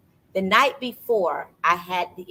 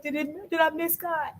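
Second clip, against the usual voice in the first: high, softer mock-weeping question; imitated tearful question; 10 semitones higher, 5 dB quieter than usual. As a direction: ask as if crying, pitched up. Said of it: "I bust out in tears".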